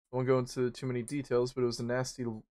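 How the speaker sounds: ordinary talk, nothing marked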